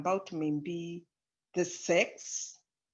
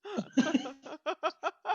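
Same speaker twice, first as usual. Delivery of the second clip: a high burst of real laughter; 7 semitones higher, 3 dB quieter than usual. Real, not acted. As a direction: laugh it out, caught off guard. Laughs at own point.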